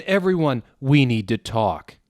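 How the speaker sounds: loud call for attention; urgent call. Acted. Hagrid calling everyone together to talk.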